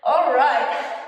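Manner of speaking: ordinary talk, nothing marked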